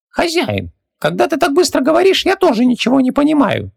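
very high squeaky little genie voice; squeaky genie servant, resigned; philosophical genie. A narrator's reading. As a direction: play a tiny high-pitched genie shrugging it off. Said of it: "заметил Хубаксис".

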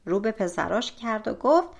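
ordinary delivery

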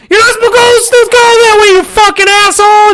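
high furious holler at a wrong-way driver; retold yell. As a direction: yell it through the window, raging. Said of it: "I yell out the window".

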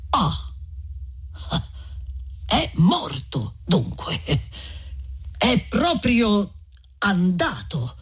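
soft, drawn-out exclamation; exclaiming. Acted. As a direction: let the relief sink in slowly. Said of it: "esclamò Don Abbondio". (esclamazione sommessa e lenta)

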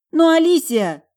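put-on very high, loud childish wail; overwrought protest. A narrator's reading. Talia stamping her foot, overwrought. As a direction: protest like a badly hurt child. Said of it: "От переполняющих её эмоций".

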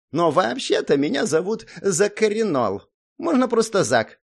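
high-pitched little gnome voice; self-important gnome. A narrator's reading. Gnome introducing himself to the hero, a little huffy.

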